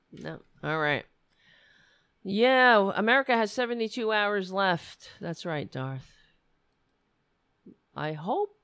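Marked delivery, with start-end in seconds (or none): none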